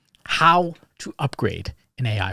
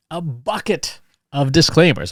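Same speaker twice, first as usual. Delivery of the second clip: normal talking voice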